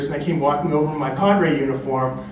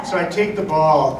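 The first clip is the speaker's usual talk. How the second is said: ordinary talk, nothing marked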